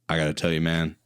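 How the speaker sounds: a hint of a deep actor's confidence; lightly voiced Carl Weathers.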